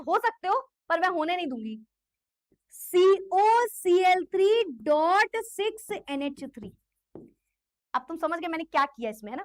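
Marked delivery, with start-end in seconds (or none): 2.7-6.7 slow dictation. Shown as a demonstration. Dictating formula slowly.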